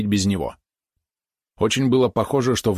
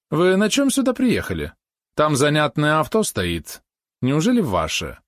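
affected dandy voice; 7 semitones higher, slower than usual. A narrator's reading. Cousin asks about car.

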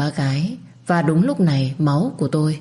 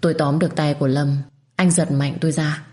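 ordinary delivery

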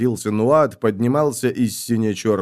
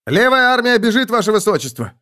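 high, raised cry of alarm; alarmed report; 10 semitones higher, 4 dB louder than usual. A narrator's reading. Soldier tells the prince the left army flees, alarmed shout. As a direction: call out bad news urgently.

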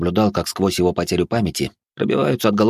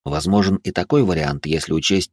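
plain reading voice

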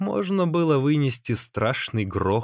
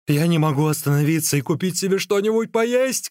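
higher, distressed shout; crying out; 5 semitones higher, 3 dB louder than usual. A narrator's reading. Hungry runner who cannot stop complains, crying out. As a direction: call out in helpless complaint. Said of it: "крикнул Ликуй".

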